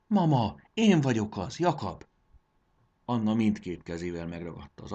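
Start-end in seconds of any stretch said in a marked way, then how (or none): 0.1-2.1 high, louder, insistent call; urgent calling. A narrator's reading. Returned son tells his disbelieving mother who he is, urgently. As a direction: call to her so she believes you.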